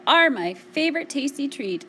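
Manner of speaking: loud and booming, in character; booming bear. A narrator's reading. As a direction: boom it out as a big bear. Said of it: "boomed the bear".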